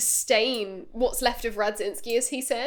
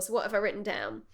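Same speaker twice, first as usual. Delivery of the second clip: ordinary delivery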